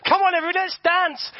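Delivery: high, loud, hyped-up call to dance; mock worship leader. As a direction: ham up an eager worship leader rallying the crowd. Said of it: "the worship leader goes".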